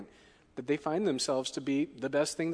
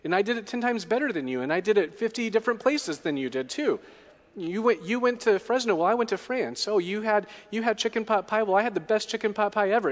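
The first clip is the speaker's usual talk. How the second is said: higher, louder, fast smug boasting; bragging one-upper; 7 semitones higher, 5 dB louder than usual. Retold or imitated. One-upper cutting into a friend's story, fast smug bragging. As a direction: top every story with a brag. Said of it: "You've all been in conversation with the one-upper".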